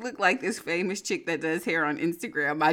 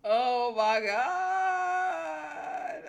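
drawn-out shriek; 10 semitones higher, much slower than usual. Real, not acted. Reacting to bird bit.

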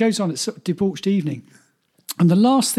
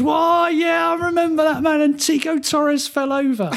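Geordie singer impression; 8 semitones higher than usual. Host retelling the singer's answer outside the pub, high mock Geordie. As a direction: cry it out in delighted mock Geordie. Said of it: "And he goes".

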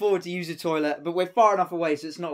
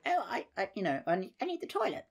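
put-on frail old lady; 3 semitones higher, 6 dB quieter than usual.

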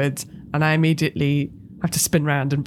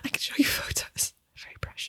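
whisper; 11 dB quieter than usual. Acted. Pleading in hushed tones.

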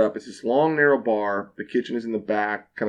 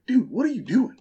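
pitched much higher, incredulous; exasperated disbelief; 12 semitones higher than usual. Retold or imitated. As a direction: confront your boss, stunned. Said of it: "I'm like".

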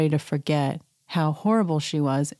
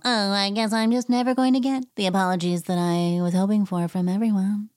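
mock Erica; 3 semitones higher than usual. Mock quote of Erica's confessional.

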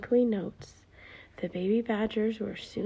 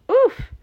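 high, loud bumped oof; a startled cartoon oof; 12 dB louder than usual. Mole crashing into Stella's bicycle, loud oof.

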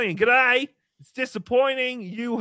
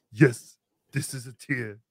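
mock tearful; 9 semitones lower, slower than usual. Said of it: "I'm not afraid to cry".

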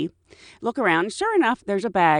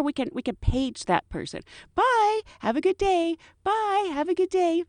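singsong flight attendant goodbye; 8 semitones higher than usual. Retold or imitated. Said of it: "I'm like".

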